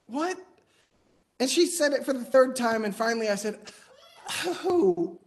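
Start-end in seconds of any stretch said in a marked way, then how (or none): 0.1-0.4 high rising "what?"; puzzled, pitched-up question; baffled repeat. Retold or imitated. Child baffled a second time. 4.5-5.2 high, drawn-out "oh"; mock revelation; dawning realisation. Child's sudden realisation of the accent.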